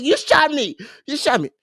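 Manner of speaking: high, overacted whining of a shot man; mock melodramatic wail, a touch overdone; dramatic whine. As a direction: ham up the drama of being shot. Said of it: "[name] always dramatic".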